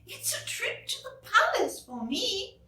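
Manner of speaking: high, light fairy voice; Tooth Fairy voice. Acted.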